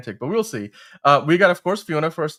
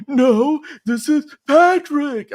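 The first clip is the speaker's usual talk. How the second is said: slow, higher dopey Patrick Star; 12 semitones higher, 8 dB louder, much slower than usual. Pretend. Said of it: "is this the Krusty Krab?".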